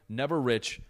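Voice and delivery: normal talking voice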